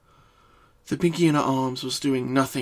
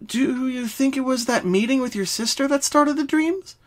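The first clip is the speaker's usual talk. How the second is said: higher, brisk studious pony; Twilight voice; 11 semitones higher than usual. Acted. Twilight questions Pinky to find the dreams' cause. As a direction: ask like a focused young scholar. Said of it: "Twilight asked curiously".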